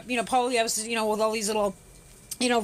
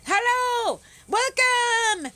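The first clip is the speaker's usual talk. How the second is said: excited greeting; 9 semitones higher, much slower than usual. Real, not acted. Greeting live stream viewers.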